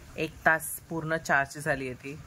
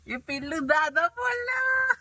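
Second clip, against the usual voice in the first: delighted cooing; slower than usual. Real, not acted. Excited baby said dada. (बाळ "दादा" बोलल्यावर आनंदाने उंच आवाजात)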